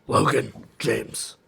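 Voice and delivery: low, slow gravelly-sounding Batman take. Pretend. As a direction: growl the name like Batman. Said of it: "it's kinda more Batman-y".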